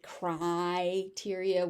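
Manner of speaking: criteria with a wide, long "cry" first; big jaw movement. Shown as a demonstration. Teacher recommending criteria with a wide-open "cry". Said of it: "with the big jaw movement".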